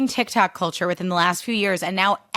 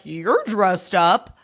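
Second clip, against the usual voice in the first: mocking drawled remark; much slower than usual. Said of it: "Or being like".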